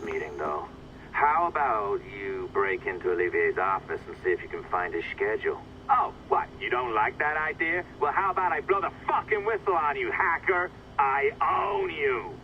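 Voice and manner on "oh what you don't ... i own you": high, taunting menace, slowing on the last words; mocking threat. Voice blackmails the hacker, mocking and menacing. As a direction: sneer it as blackmail.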